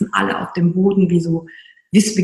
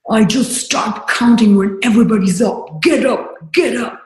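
retold coach yelling; 4 semitones higher than usual. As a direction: shout it like a drill coach pushing the class. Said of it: "er brüllt halt weiter".

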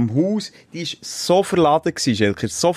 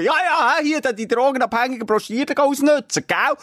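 mock cop voice; 9 semitones higher than usual. Retold police officer accusing him.

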